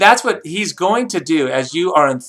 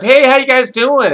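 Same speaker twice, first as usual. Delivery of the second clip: lightly acted friendly stranger, higher and louder; friendly greeting; 9 semitones higher, 6 dB louder than usual. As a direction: call out a casual hello, pitched up.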